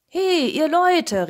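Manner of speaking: high, loud, drawn-out hail; calling out. A narrator's reading. Cat hails the woodcutters in the forest, calling out. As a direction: holler slowly to get their attention. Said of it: "rief der Kater".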